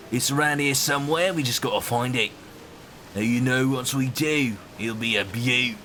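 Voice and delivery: put-on Aussie mate accent; Australian accent. Shown as a demonstration. Streamer showing Drew an accent for Kid, Australian accent. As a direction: greet them in a chummy Australian accent. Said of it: "There's your Australian accent".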